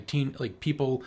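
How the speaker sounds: ordinary talk, nothing marked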